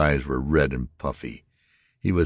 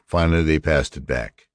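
ordinary delivery, nothing marked

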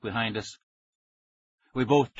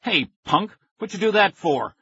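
angry; 8 semitones higher, 3 dB louder than usual. A narrator's reading. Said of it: "Billy turned angrily".

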